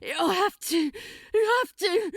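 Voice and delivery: high, loud, anguished protest; a demon compelled against his will. Acted. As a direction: fight the words out in pain.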